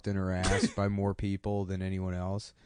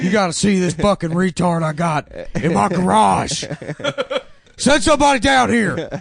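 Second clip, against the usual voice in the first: much higher, louder caller voice bragging about his son; 8 semitones higher, 9 dB louder than usual. Pretend. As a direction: holler down the phone like a proud yokel dad. Father calling Guinness about his son.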